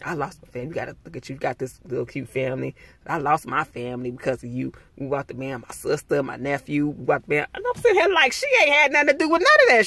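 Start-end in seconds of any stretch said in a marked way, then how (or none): 0.0-7.5 imitating a grieving woman, quiet and low; soft, lower mock plea. Stranger pleading with Star about her lost family, softly low. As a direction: play her sad excuse quietly, voice dropped down. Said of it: "She like".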